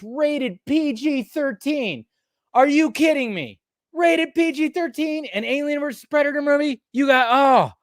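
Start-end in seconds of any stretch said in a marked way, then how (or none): 2.5-7.7 high angry disbelief; outraged rant. Real, not acted. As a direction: vent your outrage at the rating. Ranting about the PG-13 rating.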